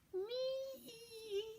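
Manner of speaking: piercing very high thin screech; owl screech. A narrator's reading. Barn owl screeching at Joe, piercing and very high. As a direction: shriek like a barn owl in the dark. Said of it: "screeched the owl again".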